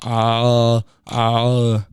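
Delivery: "uh" held with tongue far back; throaty Arnold vowel. Shown as a demonstration. Teaching listeners an Arnold voice, throaty "uh". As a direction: make "uh" loose and throaty like Arnold. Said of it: "keep your tongue in the back of your throat".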